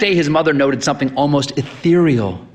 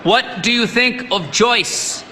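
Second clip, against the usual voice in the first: raised, slow and much higher; said louder; 13 semitones higher, slower than usual. A narrator's reading. Sick son repeating the question to the deaf priest, louder. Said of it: "Asbury said louder".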